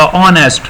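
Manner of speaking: "honest" with a silent h; correct honest. Shown as a demonstration. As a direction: start "honest" on the vowel, like "on-est". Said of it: "لفظ honest ہوتا ہے".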